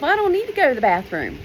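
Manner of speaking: quick denial to her own head; retorting to herself. Retold or imitated. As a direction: brush the thought off fast. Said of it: "I'm thinking to myself".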